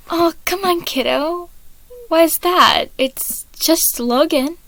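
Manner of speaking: high, gentle fatherly reassurance; reassuring dad. Acted. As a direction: soothe him like a kind dad. Patton reassuring Virgil.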